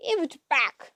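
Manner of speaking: put-on mushy-mouthed old hag demanding; yelling. A narrator's reading. Grey sister demanding the tooth back.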